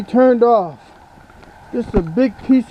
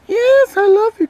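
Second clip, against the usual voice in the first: duck puppet voice; 11 semitones higher than usual. Acted. Ducky answers the owner.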